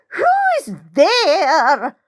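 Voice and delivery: put-on thin, high, trembling old woman's voice. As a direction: quaver it out high and thin like a frail old lady. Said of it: "Her voice is thin and high and kind of trembles".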